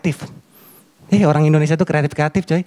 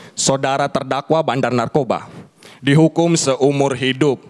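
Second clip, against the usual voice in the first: judge voice; 6 semitones lower, slower than usual. Retold or imitated. Judge passing sentence.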